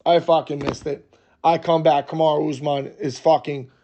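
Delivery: usual speaking voice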